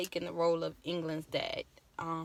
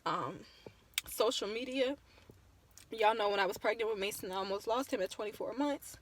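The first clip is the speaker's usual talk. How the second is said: normal talking voice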